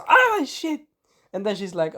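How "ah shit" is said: very high-pitched imitated pain yelp; mock scream. Retelling the wolf's trapped-tail moment, high mock scream. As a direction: squeal it like a cartoon yelp. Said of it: "He screams".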